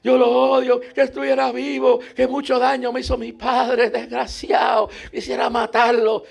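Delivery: high, loud bitter outcry; old woman on the boat cursing her father, high and loud; old woman's hatred. Retold or imitated. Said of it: "cincuenta años sirviendo al Señor".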